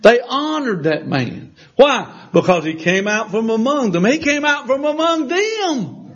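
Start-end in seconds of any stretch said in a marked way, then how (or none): 4.1-6.0 high-pitched preaching emphasis; rising emphasis. Real, not acted. Preacher driving the point home to congregation, high and emphatic.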